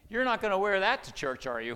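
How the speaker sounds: put-on loud, high scornful wife, parent to child; scolding wife. Wife scolding husband about his church clothes, loud and scornful. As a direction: talk down to him like a child.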